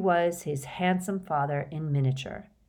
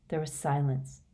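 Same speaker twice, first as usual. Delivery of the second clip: plain reading voice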